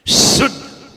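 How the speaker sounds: शुद्ध with a hard hissed "sh"; whistling sibilant. Shown as a demonstration. Teacher shows the way to say it, whistling शुद्ध. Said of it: "बोलने का ढंग".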